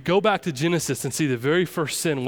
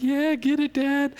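high excited cheer, much higher pitch; cheering cub; 12 semitones higher, slower than usual. Acted. Simba cheering his dad as hyenas flee, high excited cheer. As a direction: cheer on your dad like a thrilled kid.

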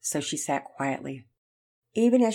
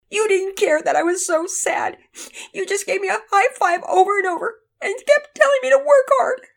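put-on high, loud, wounded child's wail; acted tearful girl, much higher voice; sobbing accusation; 8 dB louder than usual. A narrator's reading. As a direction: pour out the hurt to a parent. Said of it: "Penelope was sobbing".

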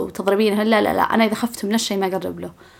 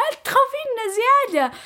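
much higher teasing mock voice; imitating friends teasing; 14 semitones higher than usual. Friends telling her she fears too much, high teasing mock. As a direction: mimic them telling her off playfully. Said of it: "وهم يقولون لي".

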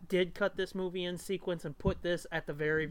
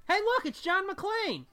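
excited; 14 semitones higher, 5 dB louder, slower than usual. Real, not acted. Spotting the actor, mock announcing.

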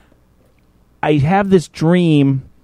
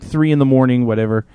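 ordinary talk

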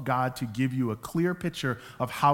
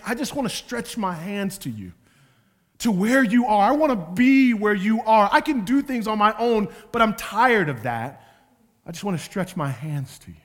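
rising impassioned plea; 5 semitones higher, 5 dB louder than usual. Acted. Voicing psalmist's plea in sermon.

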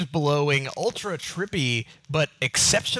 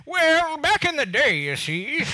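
put-on Southern old man; 10 semitones higher, 3 dB louder, slower than usual. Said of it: "a Southern old man".

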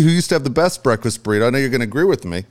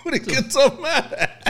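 laughing; 11 semitones higher, slower than usual. Real, not acted. Playful mock argument, laughing.

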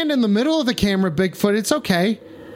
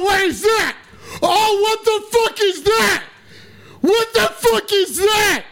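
astonished, pitched-up yelling; excited shock; 8 semitones higher, slower than usual. Real, not acted. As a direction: freak out at what's on the screen. Spotting Bigfoot on camera.

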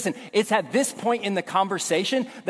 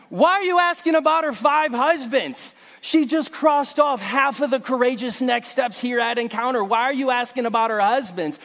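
mock exasperation; 5 semitones higher than usual. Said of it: "scolding Jesus in mock alarm".

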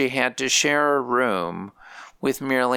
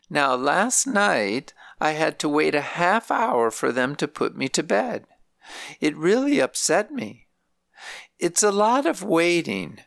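lightly voiced elderly grandmother, pitched higher; grandmother's voice; 6 semitones higher than usual. Grandmother telling visiting family about nursing home life, higher lightly voiced. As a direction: lift the voice for an old woman complaining gently.

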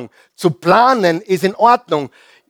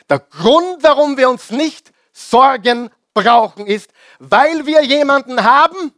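fervent preaching; 6 semitones higher than usual. Real, not acted. Preacher rising to fervour.

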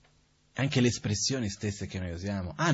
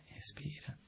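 whisper; 19 dB quieter, slower than usual. Shown as a demonstration. Telling himself to stay calm after a refusal, whispered. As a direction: breathe it out in a whisper.

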